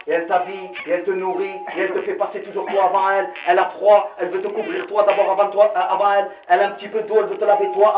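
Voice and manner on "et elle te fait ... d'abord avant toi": usual speaking voice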